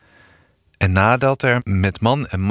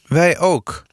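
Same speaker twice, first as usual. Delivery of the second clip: snippy; 7 semitones higher than usual. A narrator's reading. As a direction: snap it back at dad, offended. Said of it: "zei Sanne pinnig". (pinnig, hoger en luider)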